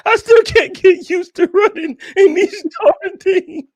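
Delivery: high, slow quote through laughter; laughing quote. Retold or imitated. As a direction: quote the line while cracking up. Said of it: "He said".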